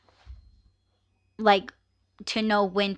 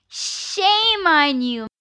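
mock scolding; 8 semitones higher, much slower than usual. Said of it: "playful scolding".

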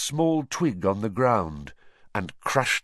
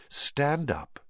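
imperious villain reckoning with servants; cold dark lord; 4 semitones higher than usual. Acted. Villain addresses cowering servants.

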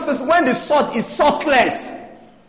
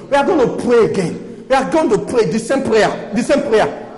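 ordinary delivery

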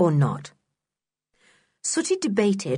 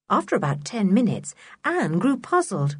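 plain reading voice

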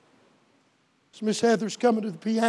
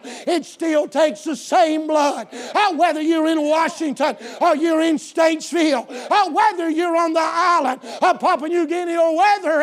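loud preaching climbing higher to a shouted finish; full-force preaching; 4 semitones higher, 7 dB louder than usual. Real, not acted. Preacher telling congregation whosoever will may come, preaching at full force.

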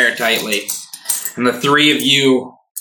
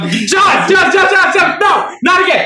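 high frantic yelling; panicked shouting; 4 dB louder, faster than usual. Acted. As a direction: yell her name in panic. Morning Bird panicked shouting.

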